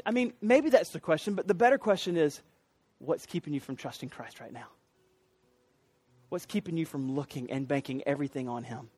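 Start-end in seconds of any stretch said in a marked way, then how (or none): 3.0-8.9 low, soft, searching questions; quiet pulpit appeal, low and intimate; hushed appeal. Real, not acted. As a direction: drop right down and ask it gently. Preacher's searching appeal to congregation.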